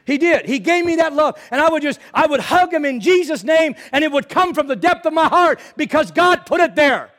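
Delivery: loud, high, impassioned sermon delivery; full-force preaching. Acted. Preacher crying out to his congregation, full force. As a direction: lift the congregation, preaching hard.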